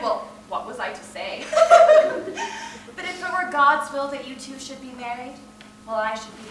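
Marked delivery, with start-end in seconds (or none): 1.5-2.9 a high, loud burst of laughter. Acted. Olga recalling the Baron, a high burst of laughter.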